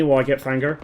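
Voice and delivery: ordinary talk, nothing marked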